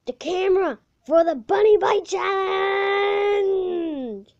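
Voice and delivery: drawn-out excited shout. Real, not acted. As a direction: call it out slow and loud. Excited announcing, shout heard.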